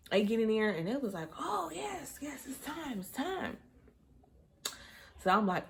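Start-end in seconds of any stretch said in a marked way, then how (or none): 1.4-3.6 high, soft confirming voice; imitated medical staff. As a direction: gently announce it in a raised voice. Staff confirming birth is imminent.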